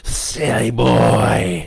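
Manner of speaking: loud, drawn-out demonic sneer; mocking devil. A narrator's reading. Devil scoffs at the boy's defiance, mocking. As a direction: stretch it out as a scornful devil. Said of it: "it laughed".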